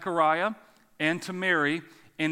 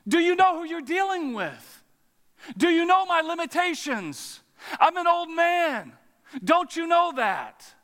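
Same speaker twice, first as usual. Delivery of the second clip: Zechariah; 11 semitones higher, 5 dB louder than usual. Acted. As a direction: challenge the angel at full pitch, incredulous. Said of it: "I hear Zechariah. I hear him say".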